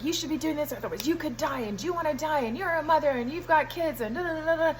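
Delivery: mock lecturing. Patient retells doctor urging surgery, mock lecturing. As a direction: reel off the warnings, mocking his lecture.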